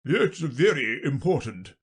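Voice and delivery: king addressing the jury; pronouncing king. Acted. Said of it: "the king said".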